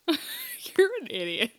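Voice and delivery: high, slow laughing jab; laughing insult. Real, not acted. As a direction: squeal it through a laugh. Laughing at dad joke.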